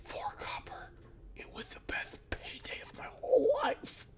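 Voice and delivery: soft, secretive whisper; conspiratorial whisper. Acted. The kid whispering the secret deal to the prisoner.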